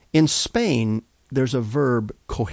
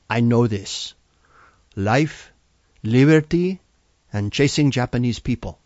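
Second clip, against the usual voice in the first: put-on a light Salvadoran accent, "liberty" popping out louder; 4 dB louder than usual. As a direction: confidently recite in a mild Salvadoran accent. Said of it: "in her strong Salvadoran accent".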